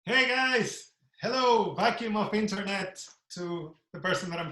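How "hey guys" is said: ordinary delivery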